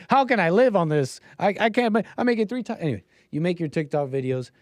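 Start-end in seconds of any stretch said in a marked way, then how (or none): 0.1-2.8 higher, whiny mock complaint; mock broke worker. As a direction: whine like a broke TikToker. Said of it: "you're like".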